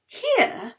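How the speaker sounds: doubtful little question; surprised question. Acted.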